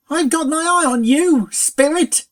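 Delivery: loud, high sudden shriek; pony shrieking a warning at the spirit. A narrator's reading. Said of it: "shrieked Pinkie suddenly".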